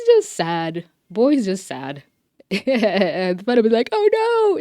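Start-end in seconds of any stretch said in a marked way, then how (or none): 3.9-4.6 very high, louder mock cry; mock dismay. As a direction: squeal a hammed-up little wail of alarm. Mock reaction of a quoted character.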